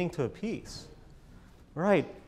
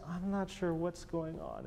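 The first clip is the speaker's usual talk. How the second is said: unsure; 7 dB quieter, slower than usual. Shown as a demonstration. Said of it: "can we have a little bit more of this".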